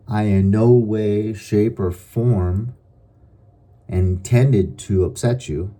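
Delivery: slow, measured, every word placed; slow deliberate assurance. Shown as a demonstration. To an angry person, slow and measured. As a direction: calmly take the heat out of it. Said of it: "I like to often say".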